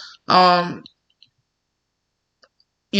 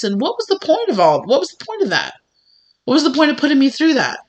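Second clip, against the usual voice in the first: higher-pitched, protesting questions; acted exasperation; 6 semitones higher than usual. Hurt rider demanding a reason from God, exasperated. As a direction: press the question as if wronged.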